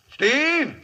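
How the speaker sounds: calling out. Acted. Calling a man over.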